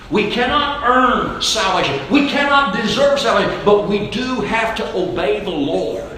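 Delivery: emphatic sermon, long stretched key words; full-force preaching. Real, not acted. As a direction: drive the point home to the congregation.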